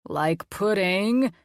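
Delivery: very slow, drawn-out silly monster voice; funny voice. Acted. Colin playing mad scientist to the kids in a funny voice. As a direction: stretch it out like a cartoon mad scientist.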